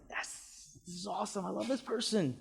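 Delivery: a soft, near-whispered delighted inner voice; subconscious quietly loving the seller; eager inner voice. Retold or imitated. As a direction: whisper it like a pleased inner thought. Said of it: "the subconscious is there, and it's like".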